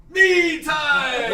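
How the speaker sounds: high, loud cheer; the guys cheering at the end of the tale. Acted. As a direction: whoop it out. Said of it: "all the guys are like".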